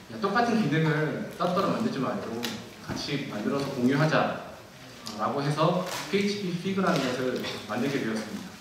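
usual speaking voice

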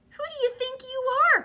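high, loud, fast outburst; indignant cry. Acted. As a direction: snap back in outrage. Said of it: "cried the Rainbow Fish".

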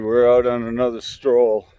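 normal talking voice

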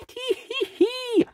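high, slow tee-hee giggle. Acted. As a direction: giggle with playful relief. Relief after the false shark.

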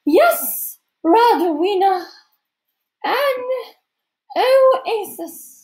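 slow, high, exhaled grandeur; theatrical, drawn-out sigh of a hostess; Missus Periwinkle. A narrator's reading. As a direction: breathe it out slowly in a lofty high voice. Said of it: "Missus Periwinkle exhaled slowly".

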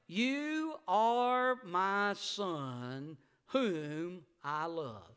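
slow, solemn heavenly voice; stretched, reverent divine voice; voice of God. Acted. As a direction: speak it slowly like God from heaven. Voice from heaven quoted.

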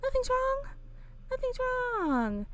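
high squeaky insisting nothing's wrong; high false reassurance. Pretend. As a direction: chirp it like a nervous liar. Voicing lying reassurance.

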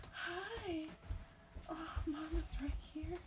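soft whisper to a newborn; real tender whisper. Real, not acted. Soothing newborn.

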